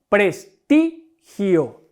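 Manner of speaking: "Prestigio" muy lento, separado en sílabas, con peso en TI; sílaba tónica marcada. Shown as a demonstration. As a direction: separa "Prestigio" en pres, TI, gio. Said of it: "¿Dónde recargo la voz?". ("Prestigio" silabeado despacio, recargando la voz en TI)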